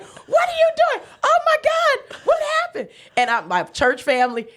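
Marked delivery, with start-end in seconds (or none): 0.0-2.9 much higher, excited visitor voices; visitors' voices. Retold or imitated. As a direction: squeal it like shocked friends at the bedside. Visitors reacting in hospital room.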